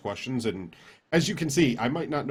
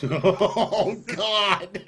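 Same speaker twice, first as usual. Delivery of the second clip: high, loud, laughing exclamation; 10 semitones higher, 6 dB louder than usual. Real, not acted. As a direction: cry out through real laughter. Cracks up at the insurance remark.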